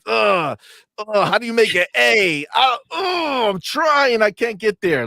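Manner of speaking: hammed-up frustrated scribbler groaning over handwriting; mock struggling writer. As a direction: ham up someone failing to write an A. Imitating trolls struggling to write.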